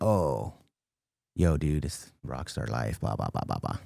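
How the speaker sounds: low, quiet mock of rockstar talk; dismissive mimicry. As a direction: trail down low into bored blahs. Mimics people hyping rockstar life.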